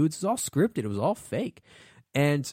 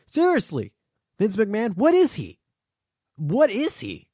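exasperated call-out; 7 semitones higher, 4 dB louder than usual. Real, not acted. Calling out Vince McMahon.

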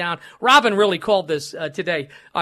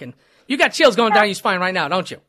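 ordinary talk, nothing marked

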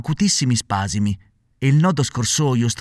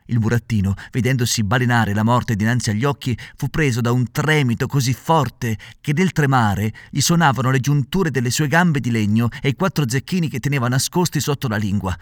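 usual speaking voice

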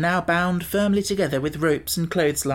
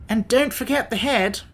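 higher-pitched insistent reminder; exclaiming; 7 semitones higher than usual. A narrator's reading. Wogglebug reminding Tip about the head, exclaiming. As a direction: exclaim it with a lifted voice. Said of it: "exclaimed the Wogglebug".

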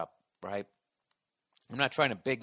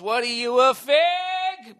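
high, loud imitation of a heckling partner; mock yelling; 12 semitones higher, 10 dB louder, much slower than usual. Host mimicking Nia reacting to his ringtone, mock yell. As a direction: stretch the last word into a mocking holler. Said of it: "Nia goes".

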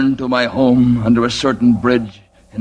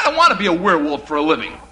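laughing through the line; 5 semitones higher, much faster than usual. Real, not acted. Son pleads, laughing.

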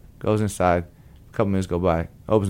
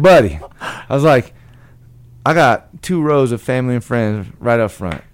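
eager pitch; 4 semitones higher, 7 dB louder than usual. Retold or imitated. Excitedly pitching Buddy to sit in.

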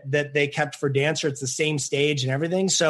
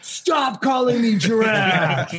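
wrestling voice; 4 semitones higher, much slower than usual. Retold or imitated. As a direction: bellow it like a monster truck announcer. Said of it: "I pull out my wrestling voice".

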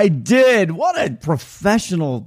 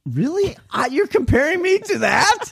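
high-pitched, wounded disbelief; incredulous; 12 semitones higher than usual. Real, not acted. Co-host compared to the disastrous boat trip, incredulous. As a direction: squeak it out, playfully offended.

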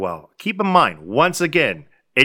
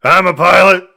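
put-on drunken pilot boast; 3 semitones higher, 8 dB louder than usual. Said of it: "He's all like".

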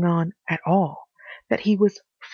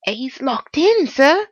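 put-on higher, louder old servant woman; housekeeper voice; 7 semitones higher, 4 dB louder, slower than usual. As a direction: voice the anxious housekeeper.

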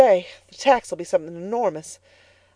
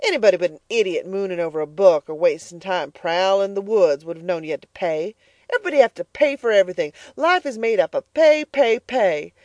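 louder, relentless harsh scolding; scornful tirade; 3 semitones higher, 7 dB louder than usual. Acted. Mrs Comstock lecturing Elnora about paying, louder. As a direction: hammer every pay home.

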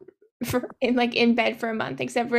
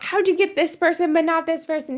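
mildly raised, higher mock yell; retold yelling; 5 semitones higher, 4 dB louder than usual. Players to Will over a failed guess, mock yelling. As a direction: imitate exasperated teammates raising their voices a little. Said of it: "they're, like, yelling at Will".

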